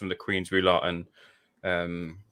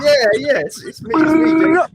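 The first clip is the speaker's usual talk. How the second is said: excited quote; 11 dB louder than usual. Retold or imitated. Quoting celebrity through laughter.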